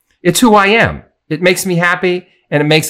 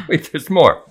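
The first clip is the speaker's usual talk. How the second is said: ordinary delivery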